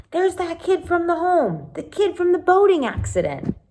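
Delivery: gossiping townsfolk. Pretend. Imagined townsfolk recognizing Sam.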